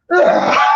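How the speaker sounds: extremely high squeaking yawn; imitated bird yawn. A man imitating his bird's yawn, extremely high. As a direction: shriek the tiny yawn like a bird.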